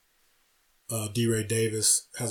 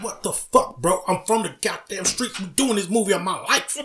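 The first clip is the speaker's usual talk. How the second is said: mock gangster actor; 8 semitones higher than usual. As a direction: overplay the wounded street actor. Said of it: "He's like".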